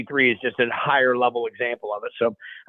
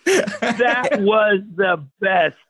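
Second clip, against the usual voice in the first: laughing delight; 10 semitones higher, much slower than usual. Real, not acted. Laughing at the memory.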